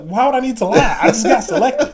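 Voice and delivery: loud, high-pitched mock indignation; retold outburst with a laugh creeping in; indignant protest. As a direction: protest your innocence, voice shooting up. Acted protest at being accused of lying.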